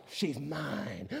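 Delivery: barely voiced, hushed; soft hushed claim. To himself about his crush, hushed. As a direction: whisper it like a secret boast. Claiming wife in school memory.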